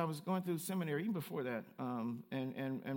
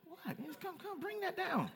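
high, much softer mock shushing; hushed high "bring that down" in mock surprise; startled hush; 12 semitones higher, 6 dB quieter, slower than usual. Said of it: "it's like".

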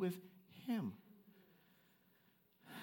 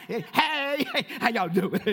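louder, bubbly mock greeting; hammed-up sunshine hello to the room; playful loud cheer of a bubbly personality; chipper greeting; 6 semitones higher, 10 dB louder, slower than usual. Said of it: "chipper and happy and bright and sunshiny".

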